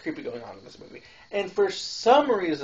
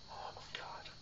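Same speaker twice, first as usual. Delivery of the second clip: whispered aside; 21 dB quieter than usual. Real, not acted. Aside about creepy Santa actor.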